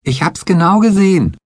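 high pitched stubborn protest; insisting. A narrator's reading. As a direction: insist she saw it, high and loud. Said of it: "beharrte Betsy".

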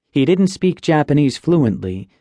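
ordinary delivery, nothing marked

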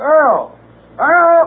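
loud high call across the yard; summoning the handyman loudly. Acted. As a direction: shout for him from far off. Calling for the handyman outside.

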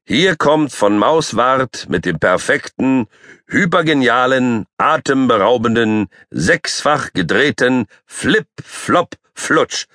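circus announcer. Acted. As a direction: announce your big trick grandly.

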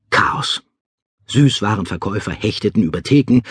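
ordinary delivery, nothing marked

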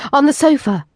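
bossy, urgent demand. Acted. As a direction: order him onto the sofa. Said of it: "I could hear my demanding tone".

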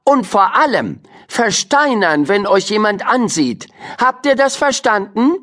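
high, insistent teacherly reminder; firm teacher. A narrator's reading. Teacher reminding the crocodile to freeze, high and firm. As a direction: drill the key rule in. Teacher instructing magic animals.

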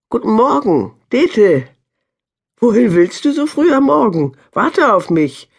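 high, bright-pitched farm wife calling out kindly; friendly greeting. A narrator's reading. Farm wife calls to young Dete, lightly voiced and friendly. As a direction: call a friendly hello up the path. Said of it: "rief Babel der jungen Frau freundlich zu".

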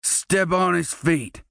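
lightly voiced bully command; ordering. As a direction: give the order coldly. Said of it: "Derek ordered".

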